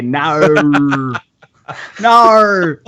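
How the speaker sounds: "nar" as a high, exaggerated Aussie "no"; laughing, hammed-up Australian "nar". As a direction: mimic an Australian saying no as "nar", stretched out. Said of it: "I actually heard you say".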